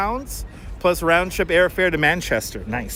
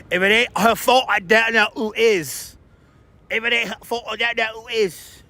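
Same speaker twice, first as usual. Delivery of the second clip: put-on Scottish accent; 6 semitones higher than usual. To livestream viewers, quoting Trainspotting in a light Scottish accent. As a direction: quote the film in a mild Scottish accent. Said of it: "one of my favorite lines from, Tra-, from Trainspotting".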